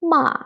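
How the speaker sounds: MA pitched high, then falling; short firm MA plunging from the top. Shown as a demonstration. Teacher showing going down, ma falling. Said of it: "going down".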